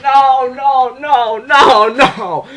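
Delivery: high, drawn-out string of refusals; wailing denial. Retold or imitated. Fan watching the friend vanish, wailing denial. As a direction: wail it like you can't bear it. Reliving denial at the character's death.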